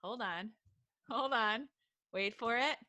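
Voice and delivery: ordinary talk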